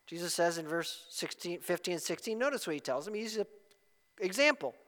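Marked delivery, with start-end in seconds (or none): none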